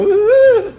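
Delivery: loud, high woo-hoo; cheering. Real, not acted. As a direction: whoop with excitement. Cheering the Six A ranks.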